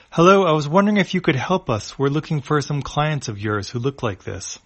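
chirpy request at the front desk; Etana's polite voice. A narrator's reading.

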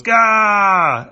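loud high startled yelp; startled gah. Acted. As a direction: blurt out in shock. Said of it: "Stanger goes".

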